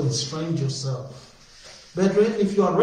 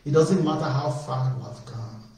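normal talking voice

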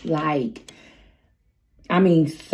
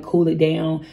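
usual speaking voice

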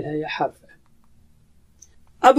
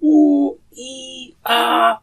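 slow, high drawn-out moans of pain; sick man's groans; 6 semitones higher, much slower than usual. Shown as a demonstration. Teacher imitates a sick man's groans for students, slow and high. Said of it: "تو کیسی آواز نکالتا ہے؟".

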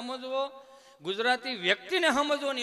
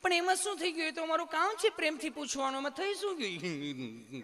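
much higher, sweet nurse voice; nurse's voice; 6 semitones higher than usual. Retold or imitated. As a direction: coax him like a kind nurse. (નર્સનો ખૂબ ઊંચો, મીઠો અવાજ)

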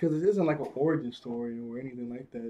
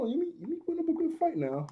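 much higher, fast, hyped-up praise; excited praise; 14 semitones higher, faster than usual. Real, not acted. Viewer cheering for Yumi in the fight, excited and high. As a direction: gush like a fan cheering her on.

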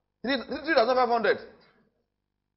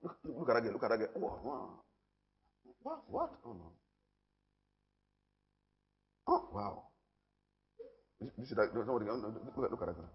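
much softer, lower mock whisper; lowered voice imitating a tempted man; hushed ogling; 10 semitones lower, 12 dB quieter than usual. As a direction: gawk quietly at a passing girl. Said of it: "I was still looking at women".

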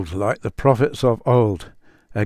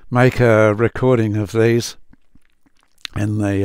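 normal talking voice

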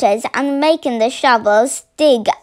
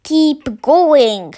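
gruff shout urging on. Acted. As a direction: bark it out over engines. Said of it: "the fat boggart shouted".

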